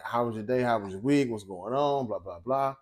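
usual speaking voice

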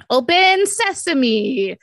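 loud, drawn-out incantation; hopeful spell-casting cry; magic-word command. Acted. Trying magic words on gadget.

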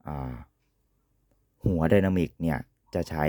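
ordinary talk

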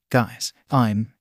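usual speaking voice

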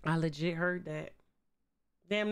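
ordinary delivery

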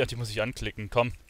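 normal talking voice

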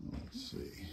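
high soft whisper. Real, not acted. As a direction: whisper while sneaking. Sneaking upstairs searching.

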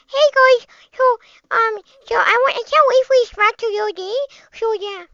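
put-on high-pitched, babyish chatter. Shown as a demonstration. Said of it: "gonna do my baby voice right now".

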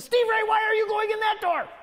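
a condemned neighbor yelling across; anguished shout. Acted. Damned neighbor cries out.

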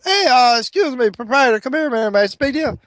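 high, eager calling across the bar; excited call over. Acted. The guy at the bar eagerly calls the saloon keeper over. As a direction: hurry him over.

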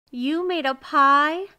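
slow, high, loud question; astonished. A narrator's reading. Miser learns neighbor made a pie, astonished. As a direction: draw it out in rising disbelief.

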